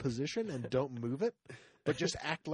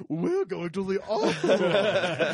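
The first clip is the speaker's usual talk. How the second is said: slow, loud mock fancy voice; drawn-out snooty announcement; hammed-up posh opera-goer; 6 semitones higher, 9 dB louder, much slower than usual. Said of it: "They were like".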